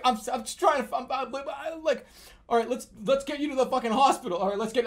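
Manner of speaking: flustered panic. Retold or imitated. Flustered about getting to hospital.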